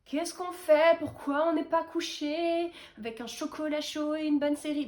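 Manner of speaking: plus aigu, plaintif et lent; voix du cerveau fatigué. Pretend. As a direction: fait parler son cerveau qui râle. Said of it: "mon cerveau, il est en mode". (voix de cerveau grognon, pleurnicheuse)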